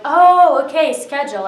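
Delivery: a high, loud "Oh" that settles into "okay, schedule."; the penny drops, then she repeats the word; sudden realization. Retold or imitated.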